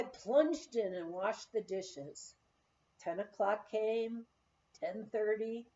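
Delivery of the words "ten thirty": plain reading voice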